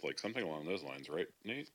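ordinary talk, nothing marked

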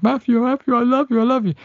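hammed-up lovesick plea; mock pleading lover. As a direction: beg him in a hammed-up falsetto. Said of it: "she turns around and says".

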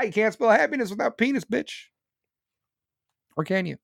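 usual speaking voice

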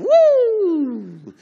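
loud, high, drawn-out siren wail; imitated siren. Driver mimicking sirens coming on behind him, loud wail. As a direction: wail like a police siren, sliding down at the end.

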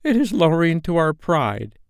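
high-pitched wounded pride; affronted crewman; grumbling pirates. A narrator's reading. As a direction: complain in a high henchman voice. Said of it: "they muttered".

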